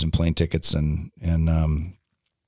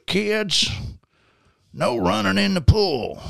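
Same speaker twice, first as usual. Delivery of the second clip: high, drawn-out Southern drawl; put-on Southern dad, pitched way up; thick Southern accent; 13 semitones higher, slower than usual. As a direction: call it out in a thick Southern twang. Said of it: "it's this very thick Southern accent".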